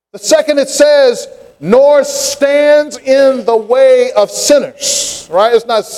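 high, ringing preacher's cadence; fervent sermon delivery; preaching. Acted. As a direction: preach it out to the congregation. Preaching at full force.

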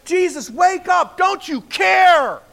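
high, loud, slow panicked shout; frightened disciples shouting at sleeping Jesus; desperate plea. Retold or imitated. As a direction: yell at him to wake up before they drown. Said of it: "they're like".

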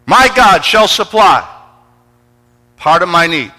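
high, loud revival preacher; full-force preaching. Acted. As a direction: preach it loudly to a big congregation.

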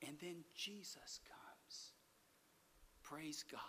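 hushed, slow whisper of wonder; awed whisper. Real, not acted. Preacher to congregation, a hushed reverent whisper.

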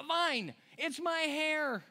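high, drawn-out exasperated outburst; frustrated. Acted. Samson finally giving his secret up to her, frustrated. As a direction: give in with acted frustration, stretching the words. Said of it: "He's so frustrated. He's like".

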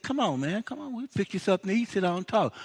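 eager welcome. Acted. Abraham welcoming the angels.

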